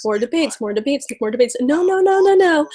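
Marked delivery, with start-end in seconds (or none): 1.6-2.7 high, sing-song mocking no's; mocking refusal. Vlogger parodying the campaign's refusals, mocking and high. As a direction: toss off the no's in a much higher, teasing voice.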